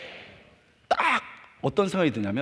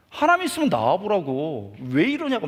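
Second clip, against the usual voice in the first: retold defiant challenge; 4 semitones higher, 6 dB louder than usual. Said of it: "막 대든 거예요".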